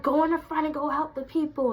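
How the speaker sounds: snapping with attitude. Retold or imitated. Manager sending worker to help customers, with attitude. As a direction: mimic a boss catching an attitude. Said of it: "She was catching an attitude with me".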